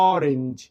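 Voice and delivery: অরেঞ্জ with a low, open অ at the start; model pronunciation. Shown as a demonstration. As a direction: say অরেঞ্জ, leaning on the opening অ. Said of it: "কিন্তু উচ্চারণ হচ্ছে অ দিয়ে". ("অরেঞ্জ" শব্দটা অ দিয়ে উচ্চারণ)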